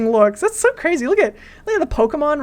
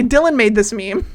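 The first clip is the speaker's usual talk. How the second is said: ordinary delivery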